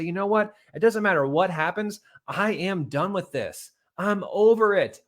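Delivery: high, loud and slow, final; fed up and done. Shown as a demonstration. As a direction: slam the door on it. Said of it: "committedness to just say".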